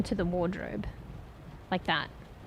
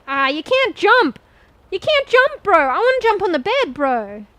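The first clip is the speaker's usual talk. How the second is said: frustrated whine; 7 semitones higher, 8 dB louder than usual. Real, not acted. Frustrated the game won't jump.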